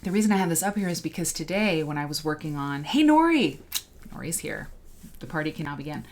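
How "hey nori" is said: a bright high call to a newcomer; calling out a greeting. Real, not acted. Host greets viewer Nori joining the live stream, calling out. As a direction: hail the friend as she arrives, louder and higher.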